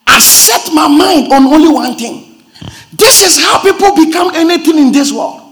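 impassioned preaching. Acted. Pastor drives the point home to the congregation, shouting sermon. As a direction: lift the church with the message. Preaching climax, loud and high.

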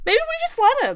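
very high, coy little suggestion; mock musing. Officials deciding to let him abdicate, mock high-pitched musing. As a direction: float the idea in a tiny high voice.

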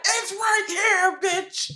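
mock angry shout. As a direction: yell it at the cashier, pointing hard. Acting an angry customer.

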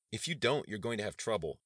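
high, loud warning; threatening demand. A narrator's reading. Student leader warning an officer, threatening. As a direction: lean hard on the threat, pushy.

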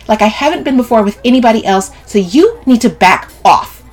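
high, loud, heated plea; emphatic, defensive outburst; indignant Sylvie, insistent. A narrator's reading. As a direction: demand that the cousin stop.

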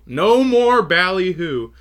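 high, loud, drawn-out mock reprimand; slow, hammed-up scolding. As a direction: stretch it out like a playful telling-off. Playful echo of the psychologist's scolding.

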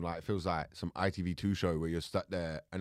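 usual speaking voice

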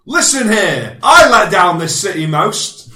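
high, loud indignant yell; mock shout. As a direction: bellow it like a pompous councillor.

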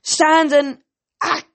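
high commanding shout ending in a choked ack; loud order, abruptly strangled; yell cut short. A narrator's reading. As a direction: bellow an order, then get squashed mid-word. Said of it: "Kronos yelled".

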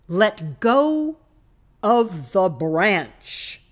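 loud, drawn-out majestic command; booming voice. A narrator's reading. Voice from above commanding the climber, majestic and booming. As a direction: boom out each word slowly. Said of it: "boomed the voice".

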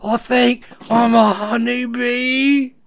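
high, drawn-out, overdramatic movie confession; imitating a crying actor's slow, high-pitched line; mock tearful melodrama. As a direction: play it super serious and tearful, hammed up. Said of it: "they're crying and it's super serious and dramatic".

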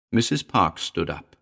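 ordinary delivery, nothing marked

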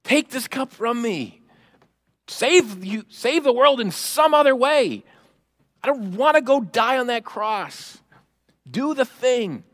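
high-pitched urgent pleading. Acted. Jesus begging the Father to spare him the cross, pleading. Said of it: "he pleaded to the Father".

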